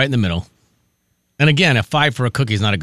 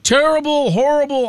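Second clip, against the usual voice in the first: mocking online critics; 14 semitones higher than usual. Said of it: "online, they were like".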